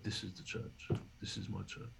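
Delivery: hushed, teary retelling of the wife; retold crying. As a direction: say it quietly, as if moved to tears. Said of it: "and she's crying".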